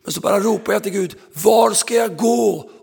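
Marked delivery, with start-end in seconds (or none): 1.3-2.7 loud, slow plea, pitched up; crying out to God. Retold or imitated. Floor layer calling to God for direction, loud and slow. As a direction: cry it upward, slowly.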